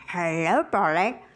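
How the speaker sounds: low budgie voice, rising at the end; talking budgie. Acted. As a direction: parrot it back on a rising tune. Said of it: "I taught him to say".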